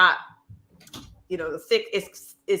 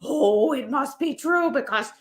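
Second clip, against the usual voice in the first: higher-pitched mock British accent; hammed-up British awe; thick British accent; 6 semitones higher, slower than usual. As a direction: gullible listener imitating a thick British accent. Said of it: "some guy with a thick British accent".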